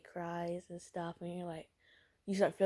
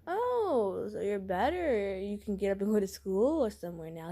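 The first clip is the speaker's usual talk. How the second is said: mock realization; 2 semitones higher, 4 dB louder than usual. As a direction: act pointedly surprised, drawing it out. Calling out the sick boyfriend's recovery.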